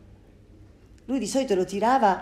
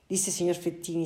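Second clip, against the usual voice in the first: ordinary delivery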